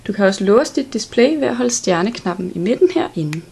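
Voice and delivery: ordinary delivery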